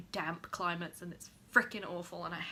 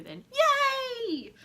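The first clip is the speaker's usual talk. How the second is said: excited cheer; 9 dB louder than usual. Real, not acted. Cheering for viewers.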